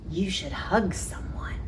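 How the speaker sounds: soft breathy whisper; whispered suggestion. Acted. An animal gently whispering advice to Jim.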